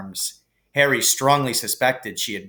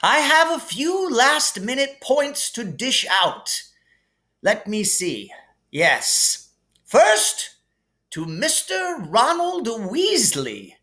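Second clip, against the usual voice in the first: higher, grand headmaster voice; Dumbledore voice; 10 semitones higher than usual. Acted. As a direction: hand out points with relish. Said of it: "said Dumbledore".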